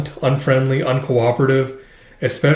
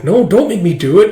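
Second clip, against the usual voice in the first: higher, louder whiny plea; mock pleading; 7 semitones higher, 6 dB louder than usual. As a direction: beg to get out of it. Junior pleading.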